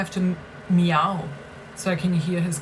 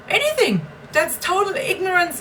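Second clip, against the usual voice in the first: 1 outraged cry; 10 semitones higher than usual. Real, not acted. Ranting about parents keeping her sick.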